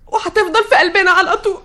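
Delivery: high voice breaking into crying; tearful. Acted. Daughter speaking of late father, starting to cry. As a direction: say it through welling tears. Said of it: "انت بتعيطي ليه؟".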